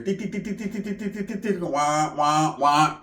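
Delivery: soft mechanical ticking under the breath; quiet robotic targeting sounds; Terminator scan noise. Pretend. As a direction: tick like a robot scanning the room. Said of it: "I'm like the Terminator".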